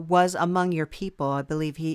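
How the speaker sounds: usual speaking voice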